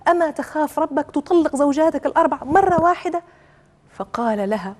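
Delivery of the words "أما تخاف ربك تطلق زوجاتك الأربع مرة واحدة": high-pitched indignant outcry, not full force; mild acted scream. A narrator's reading. Neighbour bursts out at man divorcing four wives, mild acted scream. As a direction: cry out against him in a raised pitch. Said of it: "فصرخت وقالت".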